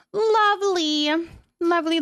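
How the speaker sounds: ordinary talk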